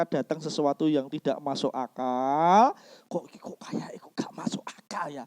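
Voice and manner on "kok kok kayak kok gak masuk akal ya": hushed, hesitant whisper to oneself; doubting whisper. Retold or imitated. Believer puzzled by what makes no sense, doubting whisper. Mimics doubter muttering to himself.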